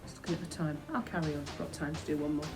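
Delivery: plain reading voice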